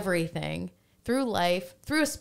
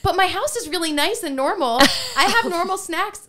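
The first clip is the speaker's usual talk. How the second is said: eager reassurance; 12 semitones higher, 10 dB louder than usual. Retold or imitated. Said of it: "I'm like".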